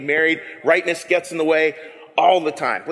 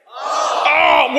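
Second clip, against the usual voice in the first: much higher, loud drawn-out All; big all; 9 semitones higher, 4 dB louder than usual. Shown as a demonstration. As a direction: belt out All with hands up. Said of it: "Let's say all with a big all".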